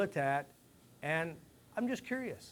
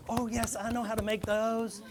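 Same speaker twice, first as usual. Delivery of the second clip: higher, eager mock voice of a guy who knows; 6 semitones higher, 3 dB louder than usual. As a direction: play the guy caught bragging. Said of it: "some of these guys out there are like".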